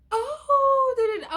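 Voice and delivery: a sudden high squeal of realization; high surprised oh. Retold or imitated. Girl realizing who her friend means, high surprised oh.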